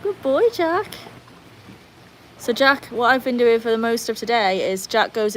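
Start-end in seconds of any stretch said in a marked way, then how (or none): 0.0-0.9 high, swooping pet-talk voice; doting dog praise. Real, not acted. Owner praising dog Jack, doting high voice.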